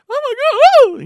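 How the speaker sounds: very high, loud mock shriek; hammed-up howl of alarm; imitating nervous mom. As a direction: wail like a panicking mother. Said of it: "nervous hound".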